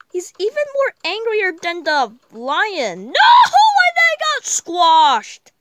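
loud, high, crying shout at the game; real anguished shout. Real, not acted. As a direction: wail it out as the creature gets squashed. Lion dies in game, yelling.

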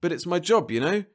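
high, apologetic lilt; meek. A narrator's reading. Monitor excusing his job to the offender, meek. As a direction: excuse yourself meekly. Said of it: "said Jeb meekly".

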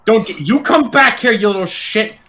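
high loud yell at the ghost. Real, not acted. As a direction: shout it after the runaway. Yelling at the fleeing ghost.

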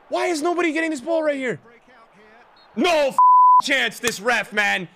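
high-pitched angry complaint; frustrated outburst. Real, not acted. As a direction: protest loudly at team and referee. Complaining about ref and players.

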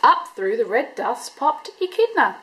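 ordinary delivery, nothing marked

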